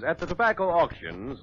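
usual speaking voice

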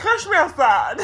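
loud mock meme catchphrase; catchphrase imitation. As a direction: blurt the meme line on request. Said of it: "Look into the camera and say".

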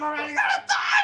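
very high, loud panicked shriek; frantic wail of defeat; desperate scream. Real, not acted. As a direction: scream as the clock runs out. Timer runs out.

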